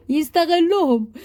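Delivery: milk the word with a grin; smiling mock-cunning drawl; playful drawn-out. Joking about reusing the paper.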